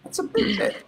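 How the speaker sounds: high squeaky mocking repeat; silly high voice. Shown as a demonstration. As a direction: squeak it out like a cartoon. Repeats own line in joke voice.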